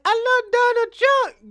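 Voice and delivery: high, slow gushing squeal; mock swooning supporter; mock adoring fan. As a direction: gush like a starstruck fan. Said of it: "she was like".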